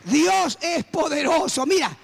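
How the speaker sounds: slow, high-pitched proclamation; triumphant preaching. Real, not acted. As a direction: stretch each word out to the whole congregation in triumph. Preaching climax after the story.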